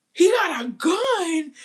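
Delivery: high, loud, drawn-out shocked question; slow high alarmed shout; alarmed disbelief. Retold or imitated. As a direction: stretch it out in horror, way up high. Alarmed at hearing of gun.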